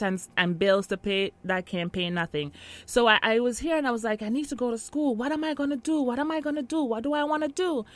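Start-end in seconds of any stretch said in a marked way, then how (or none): 4.2-7.8 higher-pitched, mildly anxious self-questioning; retold fretting. Newcomer recalling worries about school and work, fretting. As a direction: fret aloud in repeated questions.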